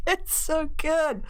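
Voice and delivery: slow, drawn-out, through laughter; savoring. Real, not acted. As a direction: relish every word slowly. Savoring the news.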